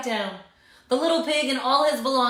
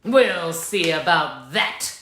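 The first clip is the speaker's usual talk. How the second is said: growled; 6 semitones lower, slower than usual. Acted. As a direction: lower slow wolf growl. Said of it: "growled the big bad wolf".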